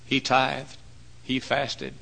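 ordinary delivery

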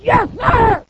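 high, drawn-out yes sir; mock-timid high reply; meek Moses. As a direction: answer like a chastened boy. Moses meekly obeying.

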